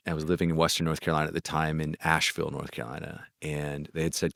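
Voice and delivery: ordinary delivery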